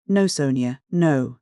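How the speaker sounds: plain reading voice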